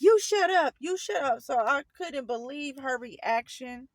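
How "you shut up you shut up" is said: imitated sharp silencing, raised pitch; retold snappish order, loud then easing; scolding mother. Mother at work silencing her daughter, mock angry scolding.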